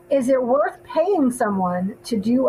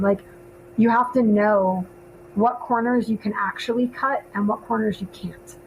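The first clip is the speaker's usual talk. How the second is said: ordinary talk, nothing marked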